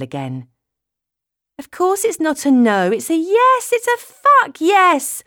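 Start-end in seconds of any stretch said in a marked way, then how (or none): 1.6-5.2 high, loud excited babble; excited babbling. Acted. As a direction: blurt it out giddy and overjoyed. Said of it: "I babble".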